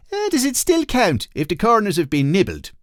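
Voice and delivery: Blimey O'Reilly questioning the card, high; high, doubtful passerby. Acted. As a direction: ask it like a fussy rule-checker.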